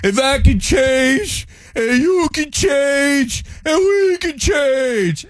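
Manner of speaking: high, slow boxer's victory-speech shout; Rocky Balboa. Retold or imitated. Boxer winning over the Russian crowd, high impassioned impression. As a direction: cry it out slowly like a battered champion. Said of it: "in your Rocky voice".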